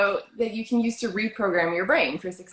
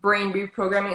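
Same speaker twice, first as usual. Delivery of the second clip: normal talking voice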